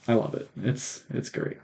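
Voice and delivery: dropping to a near whisper; sheepish confession. Real, not acted. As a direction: admit it softly, almost under your breath. Guilty-pleasure confession.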